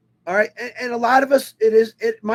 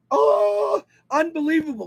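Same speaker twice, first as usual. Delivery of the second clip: awed exclamation; 5 semitones higher than usual. Real, not acted. Awed by the mountain view.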